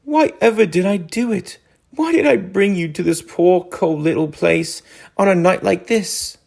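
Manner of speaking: high, wailing mole voice; Mole's high dismal lament to Rat. A narrator's reading. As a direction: plead in misery, voice lifted high. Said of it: "he cried dismally".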